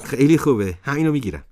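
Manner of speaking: put-on a thrilled boy claiming the jacket; delighted. A narrator's reading. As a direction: burst out with happiness at the mirror.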